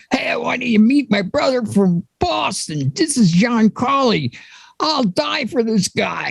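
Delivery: high-pitched mock Boston accent; imitated Boston accent. Introducing a brother from Boston at the bar, imitated Boston accent. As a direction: play an effusive Boston buddy, pitched well up. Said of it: "you were talking in that Boston accent".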